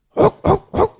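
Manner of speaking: high, loud barked woof; dog barking. Acted. Preacher imitating a dog, loud barking. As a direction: bark like a dog.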